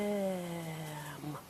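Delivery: RAM drawn out softly and low; stretched out. Shown as a demonstration. Teacher prompting a child's rhyme, stretched out. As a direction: stretch RAM slowly, very soft. Sounding out, then blended word.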